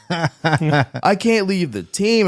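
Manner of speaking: hammed-up laughing plea; mock whine, laughing. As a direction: stretch the excuse out as a joke on himself. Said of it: "repeats his excuse, laughing".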